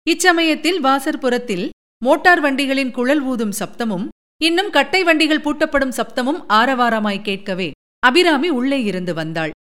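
usual speaking voice

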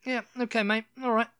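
playful imitation of borrowed British accent; imitated British accent. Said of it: "she was just trying".

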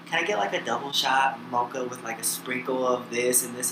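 lightly imitated Kardashian coffee order; Kardashian accent. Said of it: "bring up the Kardashian accent".

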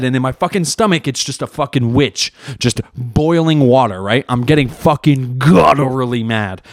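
ordinary talk

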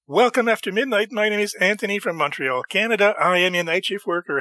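radio host intro. Acted. Podcast intro.

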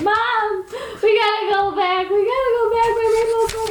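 put-on high, whiny kid begging mom; pleading little girl. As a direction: beg like a panicked child who lost her doll. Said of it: "I was like".

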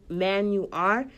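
ordinary talk

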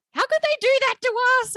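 high, mock-anguished wail at the TV; distraught outcry. Podcaster retelling her reaction to a sad episode, high anguished outcry. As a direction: cry out in betrayed disbelief, pitched high.

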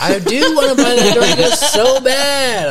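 high, slow, eager wanting; excited. Real, not acted. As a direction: stretch it out with longing. Longing to buy the car.

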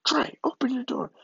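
dad's angry demand. Retold or imitated. Dad outside his son's barricaded door, high impatient demand. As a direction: imitate a father demanding entry. Dad trying to get into room.

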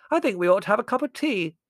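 loud, high oblivious interjection; booming high aunt voice; hard-of-hearing aunt. A narrator's reading. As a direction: chime in loudly as if deaf. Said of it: "said Aunt Fan".

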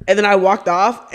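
normal talking voice

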